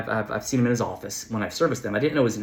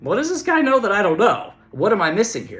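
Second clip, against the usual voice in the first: higher, baffled inner voice; retold puzzlement, pitched up; bewildered thought; 8 semitones higher, 4 dB louder than usual. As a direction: wonder what he's hiding.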